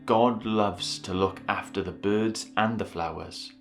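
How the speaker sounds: ordinary delivery, nothing marked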